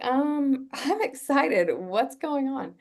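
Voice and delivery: high, slow, with a laugh; nervous excitement. Retold or imitated. Telling Pete she's his match, high and nervously excited. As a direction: pitch up, half-laughing, fishing for a reaction. Pete silent after her news.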